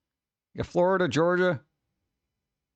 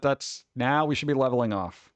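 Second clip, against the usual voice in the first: usual speaking voice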